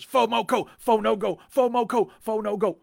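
high, loud back-and-forth chanting; mock screaming match. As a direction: trade shouts like two rival camps. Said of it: "they'd have these screaming matches".

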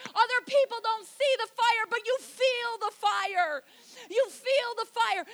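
loud, high, driving sermon delivery; preaching at full force. Real, not acted. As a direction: fire up the congregation at full volume.

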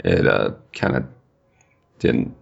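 deadpan. Real, not acted. Deadpan letdown punchline.

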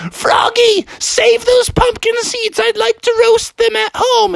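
very high, squeaky calling-out; little sister Alyssa. Acted. Alyssa calls to Froggy mid-chase, high and insistent. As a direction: pipe up insistently in a child voice.

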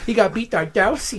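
high taunting sing-song; mocking high voice. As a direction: tease it in a high voice. Mocking social media post.

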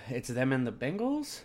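ordinary talk

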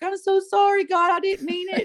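high-pitched pleading, crying-style apology; mock tearful apology. Widow retelling her apology to God after getting mad, high tearful pleading. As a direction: apologize in a high, whimpering plea. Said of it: "then I would cry".

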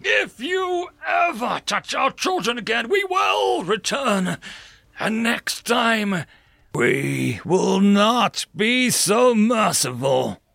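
slow, menacing, high shouted threat; through clenched teeth. Acted. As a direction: spit each word out with cold rage. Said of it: "as it spoke through clenched teeth".

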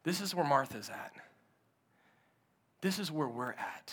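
low, hushed sermon voice drawing the church in close; hushed preaching. Acted. Pastor hushing the congregation about wanting to quit, hushed preaching. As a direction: preach it softly, almost confiding.

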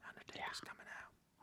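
awed whisper; hushed like a frightened crowd. Retold or imitated.

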